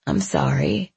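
whispered and breathy, close and intimate; breathed. A narrator's reading. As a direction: breathe it out almost without voice. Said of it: "he breathed".

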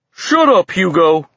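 high, loud retort; yell. A narrator's reading. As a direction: yell back at a heckler. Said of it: "Tiny yelled".

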